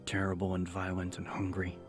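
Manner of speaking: usual speaking voice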